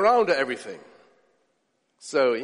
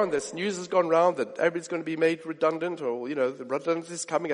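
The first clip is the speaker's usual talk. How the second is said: ordinary delivery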